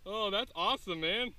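high, swooping thrilled voice; real delight. Real, not acted. Angler thrilled by the giant shark, delighted. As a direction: marvel at it, pitched well up. Thrilled after seeing the fish.

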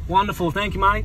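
loud, cheery Aussie reply; Australian accent. Pretend. Replying to the drive-through server, Australian accent. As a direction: answer as a matey Australian. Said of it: "Do it in an Australian accent".

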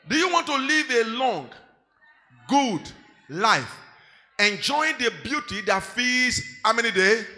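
loud, emphatic congregational reading; reading aloud loudly. Real, not acted. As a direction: belt the verse out with the congregation. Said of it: "read this with me loud and clear".

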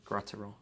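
Graterol as GRAH-teh-roll, said softly; corrected pronunciation. Shown as a demonstration. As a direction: softly try Graterol, opening with "grot". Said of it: "it's Grot".

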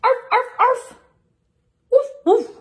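high, loud yaps then woofs; puppy and dog barks. Acted. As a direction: bark like two happy dogs. Dogs bark in picture book.

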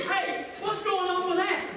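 hammed-up helpless asker; calling for help. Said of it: "we go".

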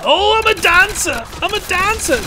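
excited high shout. Real, not acted. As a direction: yell it out with glee. Excited exclamation.

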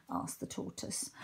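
ordinary delivery

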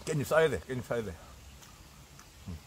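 chewing while talking; muffled words around food; mouth full. Real, not acted. Seen 1: cheeks puffed, lips pressed, chewing between words at a meal.

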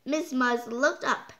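plain reading voice

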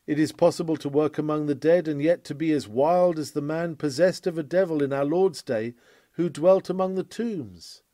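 ordinary talk, nothing marked

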